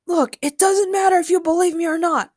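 high-pitched, urgent outburst; insistent shout. A narrator's reading. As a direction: shout to make them listen. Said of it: "Ben shouted".

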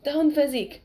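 urgent yell. A narrator's reading. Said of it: "and he yelled".

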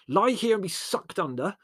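indignant, urgent complaint; snarl. A narrator's reading. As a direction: snarl for help, exasperated. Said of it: "snarled Larry".

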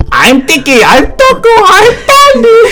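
high squeaky character voices; high, playful puppet roll call. Pretend. Voicing show puppets.

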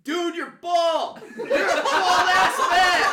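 slow, high, loud yelled retort; yelling back. Retold or imitated. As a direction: yell it back slowly, laughing at the end. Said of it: "I'm like".

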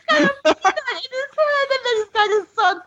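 high, fast, laughing; giggling high-pitched words. Real, not acted. Laughing retelling.

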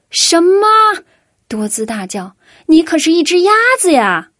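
high, loud "什么"; shocked yell. A narrator's reading. Dodds learns the duck can't swim, shocked shout. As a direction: shout in disbelief.

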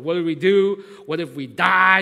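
loud, desperate cry to God. Retold or imitated. Staff crying out to the Lord in fear, loud. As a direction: cry out in panic. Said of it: "crying out to the Lord".

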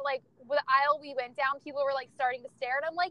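usual speaking voice

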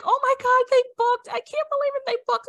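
high, loud burst of excitement; excited client. Retold or imitated. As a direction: imitate a client thrilled to report a booking. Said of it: "She's so excited. She's like".

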